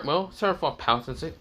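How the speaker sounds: ordinary delivery, nothing marked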